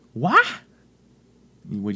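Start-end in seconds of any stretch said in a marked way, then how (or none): 0.1-0.5 Raphael. Retold or imitated. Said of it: "And he'd go".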